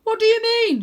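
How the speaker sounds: very high, loud alarmed shout. Acted. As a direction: grandad yelling back in worry. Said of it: "shouts Grandad".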